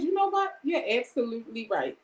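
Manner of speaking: mock-polite surrender through hurt; fake agreement. Wife concedes to the other woman, high and tearful. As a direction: voice jumps up high, breaking on the edge of tears. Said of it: "I went just like this".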